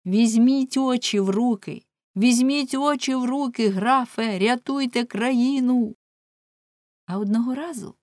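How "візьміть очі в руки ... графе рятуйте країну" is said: higher-pitched urgent shout; an urgent plea called out to the count; crying out. A narrator's reading. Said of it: "відскочив і крикнув". (високий благальний вигук)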